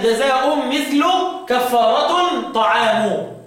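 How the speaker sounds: "جزاء" and "كفارة" with sounded -un endings; tanween and raf'. Shown as a demonstration. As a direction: stretch jazaa'un mithlu, kaffaaratun ta'aamu for "جزاء مثل كفارة طعام". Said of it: "بالتنوين والرفع".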